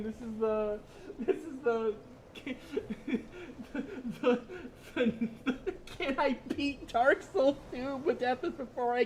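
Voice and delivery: high, loud giggly words; laughing. Real, not acted. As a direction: keep laughing through it. Still laughing reading chat.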